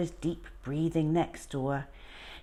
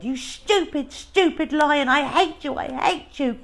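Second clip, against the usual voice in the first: high, loud furious shouting; a boy yelling hate to drive his lion off; angry yelling; 11 semitones higher, 7 dB louder than usual. Acted. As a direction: hurl it at him, desperate and angry. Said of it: "Bertie yelled".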